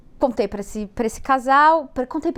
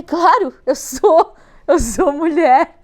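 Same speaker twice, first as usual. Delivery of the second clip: indignant retort; 5 semitones higher, 9 dB louder, slower than usual. Retold or imitated. Indignant reply to odd question.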